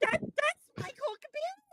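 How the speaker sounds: high squeal of surprised disbelief; stunned disbelief. Retold or imitated. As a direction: gasp it out like you can't believe your eyes. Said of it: "I was like".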